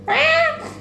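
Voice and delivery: a sudden squeaky high Ah; high mock shriek. Recapping the plot, she shrieks in mock shock. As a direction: let out a short high shriek.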